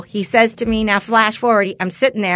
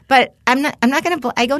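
usual speaking voice

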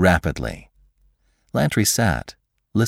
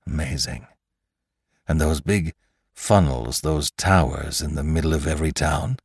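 guarded Lantry. Acted. Lantry probes about the era.

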